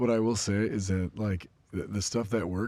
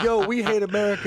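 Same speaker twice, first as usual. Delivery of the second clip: mock villains; 14 semitones higher, 9 dB louder than usual. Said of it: "they're like".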